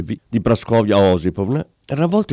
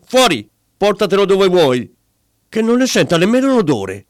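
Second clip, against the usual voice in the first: furious scolding; 8 semitones higher than usual. Acted. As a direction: rant at him, outraged. Wife berating the barber husband. (moglie che insulta il marito, furiosa)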